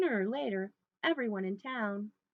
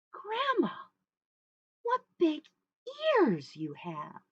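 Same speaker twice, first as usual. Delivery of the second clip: high opening, slow falling wonder; wondering little girl; 9 semitones higher, 5 dB louder, slower than usual. Acted. Little Red looks closer at Grandma, high and puzzled. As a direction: start pitched up, then ease down slowly.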